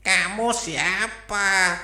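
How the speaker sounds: high, slow rooster voice; rooster asking. Acted. Rooster asks the owl who it is, high and slow. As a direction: ask like a puzzled rooster. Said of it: "tanya si ayam jago".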